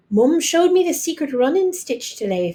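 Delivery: put-on bright proud child voice; proudly. A narrator's reading. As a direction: show off your work. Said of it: "Fiona said proudly".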